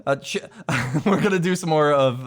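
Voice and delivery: usual speaking voice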